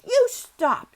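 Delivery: high, loud furious command; angry near-shout. A narrator's reading. Girl ordering screaming boy to stop, angry near-shout. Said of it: "she almost shouted".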